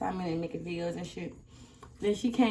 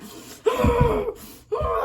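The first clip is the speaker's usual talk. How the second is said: loud, high sobbing gasp; a loud, high, crying gasp; 7 dB louder than usual. Retold or imitated. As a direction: gasp as if starting to cry. Crying while hair pulled.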